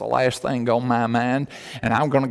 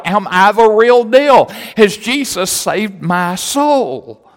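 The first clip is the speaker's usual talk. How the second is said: raised, forceful pulpit voice; fervent preaching; 7 semitones higher, 7 dB louder than usual. Real, not acted. Preacher challenges congregation to examine their salvation, forceful preaching. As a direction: press it on the congregation with mounting urgency.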